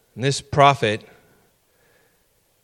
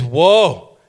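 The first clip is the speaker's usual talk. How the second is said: loud, higher cry of dread; prophet's cry of woe; 5 semitones higher, 8 dB louder than usual. Retold or imitated. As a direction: cry out in awe and fear. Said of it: "And he says".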